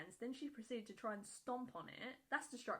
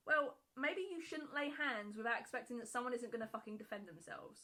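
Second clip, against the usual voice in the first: normal talking voice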